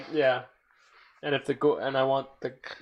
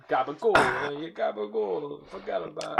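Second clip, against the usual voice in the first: mock New York Italian. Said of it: "making fun of the New York Italian".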